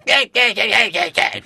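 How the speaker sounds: high, loud, chest-puffed cartoon kid; early Cartman voice. Shown as a demonstration. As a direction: puff your chest out and bark it high. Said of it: "puffing my chest out and really going".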